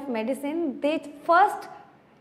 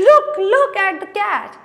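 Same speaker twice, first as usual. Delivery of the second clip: higher and louder, eager to show; excited pointing; 6 semitones higher, 7 dB louder than usual. Acted. Tom points Aunt Polly to the mad cat, loud and high. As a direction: urge her to look, bursting with it.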